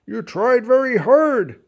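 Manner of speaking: proud grandpa. A narrator's reading. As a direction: praise her warmly and fully. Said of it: "Grandpa said".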